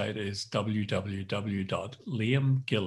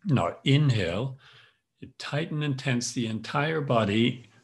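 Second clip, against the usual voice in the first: calm instructor guiding each tense and release; guided yoga nidra. Acted. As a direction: lead the body scan, firm on tighten, easing on let go. Leads tense-relax yoga nidra.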